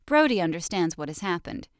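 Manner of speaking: usual speaking voice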